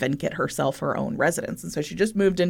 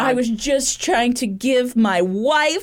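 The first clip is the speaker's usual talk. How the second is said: mock husband voice; 8 semitones higher than usual. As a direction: play the whiny excuse-making husband. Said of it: "he was like".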